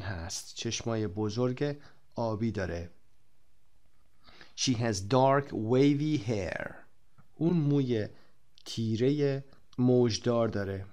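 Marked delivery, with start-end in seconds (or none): 4.6-6.7 slow model reading. Shown as a demonstration. Teacher models book sentence slowly.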